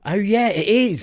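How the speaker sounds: put-on West Country accent. Mocking West Country agreement.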